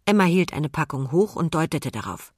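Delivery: usual speaking voice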